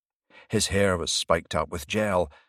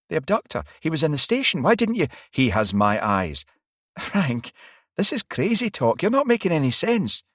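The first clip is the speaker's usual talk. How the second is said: Varry voiced higher, rattled and disbelieving; a worried detective pushing back; alarmed young woman; 9 semitones higher, 5 dB louder than usual. A narrator's reading.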